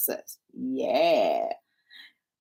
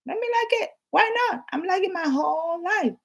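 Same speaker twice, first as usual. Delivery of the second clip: high excited squeal; 13 semitones higher than usual. Real, not acted. Vlogger squealing over liking her video. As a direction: squeal it happily as you like it.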